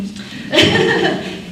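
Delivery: a high, breathy, embarrassed giggle; embarrassed laugh. Real, not acted. Host laughing at her own emotion, high embarrassed laugh.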